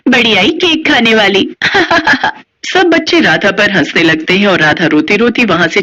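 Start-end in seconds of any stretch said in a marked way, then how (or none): 1.5-2.3 high falling jeer; mocking laugh. Rich girls laughing at Radha, high falling jeer. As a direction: finish the taunt with a laugh.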